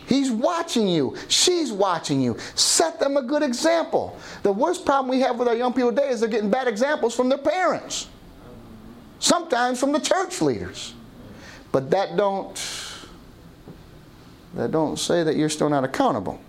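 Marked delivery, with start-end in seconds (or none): 0.1-10.9 emphatic preaching. Real, not acted. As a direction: press each warning on the congregation. Preacher exhorts congregation at full force.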